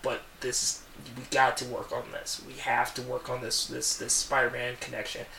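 hushed Mary Jane pleading; soft pleading. Retold or imitated. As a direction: plead gently to him, almost under breath. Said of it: "she's like".

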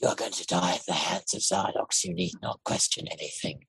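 low, soft, flat menace in a different voice; Kovacs voice. Shown as a demonstration. Contrasting Zardox's lines in the Kovacs voice, low and soft. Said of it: "that in the other voice is".

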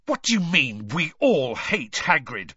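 bitter snapping comeback; spat. A narrator's reading. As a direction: spit the words at him. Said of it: "Harry spat at Malfoy".

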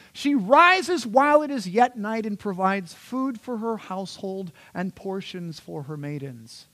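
high, loud, slow grand proclamation easing toward the end; hyperbolic superwoman reading. Shown as a demonstration. Preacher reads Proverbs as superwoman hype, grand proclamation. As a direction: read it like a superhero's epic billing.

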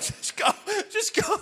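high, strangled words through acted laughter; can't stop laughing. Husband waving his wife away, imitated uncontrollable laughter. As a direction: squeeze it out while helpless with laughter. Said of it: "I cannot stop laughing".

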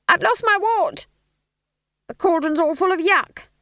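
high, loud flustered cry; panicked wail. A narrator's reading. Junior apprentices reporting disasters before curtain, high and panicky. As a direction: complain in a backstage panic.

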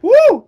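high, loud imitated crowd "woo"; crowd whoop. Imitating the crowd after the yelled lyric, high loud whoop. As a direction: whoop like an excited concert crowd. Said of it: "everyone in the crowd, like, started going".

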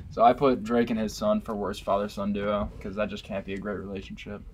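ordinary delivery

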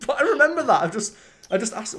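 real laughter breaking into speech; laughing. Real, not acted. Recalling the water standoff with his boss, laughing hard.

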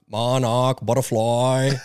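put-on slow, loud Boston accent, R dropped. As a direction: relish the broad Boston vowels. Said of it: "Monarch butterfly with a Boston accent".

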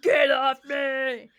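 loud, drawn-out imitation scream; screaming at a demon. Retelling a ghost-show man yelling at a demon, mock screaming. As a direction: mimic a man bellowing at a demon. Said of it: "screaming at the demon".

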